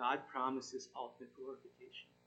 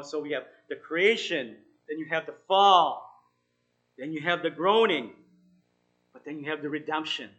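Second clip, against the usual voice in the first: louder, building preacher's cadence; emphatic preaching; 11 dB louder than usual. Acted. Pastor preaching the stages of salvation, emphatic and loud. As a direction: drive each step home to the congregation.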